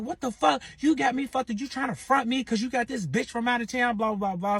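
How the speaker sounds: imitating her high-pitched, indignant rant; high, heated retelling that runs on into blahs; mock angry confrontation. Baby mama confronting him over another woman, mock angry high rant.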